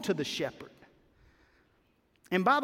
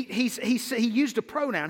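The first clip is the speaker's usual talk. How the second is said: normal talking voice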